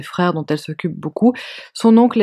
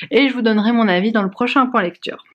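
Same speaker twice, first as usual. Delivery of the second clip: ordinary talk, nothing marked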